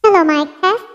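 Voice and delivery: high, shrunken effect voice; pitch-bent small voice. Shown as a demonstration. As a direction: test the mic through a pitch-up effect. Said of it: "lumiit yung boses ko". (maliit at matinis na boses mula sa pitch bend)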